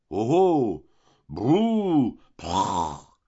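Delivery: loud hooting oh oh then a rolling brrr, prrr; the whole table whooping like fireworks going off; rowdy exclamations. A narrator's reading. Guests whooping at Vautrin's wine offer, loud rolling brrr. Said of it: "poussa des exclamations qui partirent".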